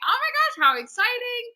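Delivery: high-pitched acted delight; excited. Replaying her reaction to a role offer, excited. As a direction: squeal it lightly, replaying the thrill.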